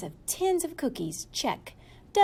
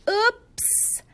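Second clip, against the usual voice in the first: high oops; 9 semitones higher, 4 dB louder than usual. Acted. Moose caught out.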